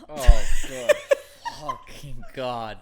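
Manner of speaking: high squealed laugh, then slowing and dropping; laughing exclamation. Real, not acted. Friend reacting to the Bible claim, real helpless laughter. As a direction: burst out laughing through the words. Cracks up at Bible flat-earth remark.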